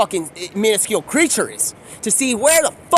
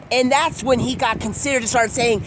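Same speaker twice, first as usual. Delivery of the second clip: usual speaking voice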